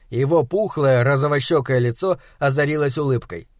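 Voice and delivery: usual speaking voice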